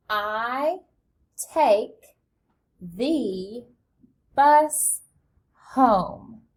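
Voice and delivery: slow, each word set apart with pauses; teacher modelling a sentence slowly; word-by-word model reading. Shown as a demonstration. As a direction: read it out one word at a time for kids to follow. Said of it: "Read that with me".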